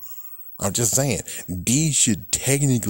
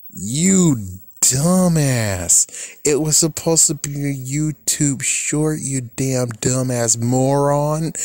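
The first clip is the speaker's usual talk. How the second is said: raised, higher voice, drawing out the insults; exasperated telling-off; 4 semitones higher, slower than usual. Retold or imitated. Creator berating the company that blocked the clip, exasperated.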